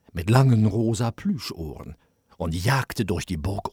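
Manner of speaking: ordinary delivery, nothing marked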